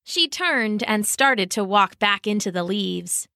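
ordinary delivery, nothing marked

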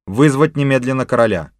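commanding order. Acted. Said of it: "распорядился начальник полиции".

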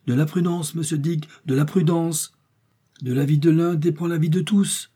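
high-pitched appeal for caution; urgent pleading. A narrator's reading. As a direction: plead with a reckless companion. Joey urges the hunter to caution.

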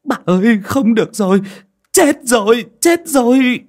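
high, loud frightened blurting; panicked stammer. Acted. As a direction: blurt out the news in terror. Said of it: "miệng lắp bắp".

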